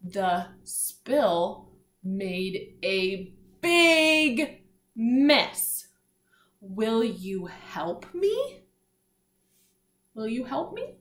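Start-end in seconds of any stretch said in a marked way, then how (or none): 0.0-5.9 pointing-along reading pace, mess punched; slow read-along. Shown as a demonstration. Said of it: "Let's read it all together now". 6.6-8.6 very slow, each word drawn out, rising at the end; slow read-along. Shown as a demonstration. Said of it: "Let's read it all together now".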